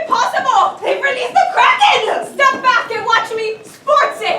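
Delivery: rally the party against the beast; a laughing hero calling the charge; heroic mock shout. Battle with the Kraken.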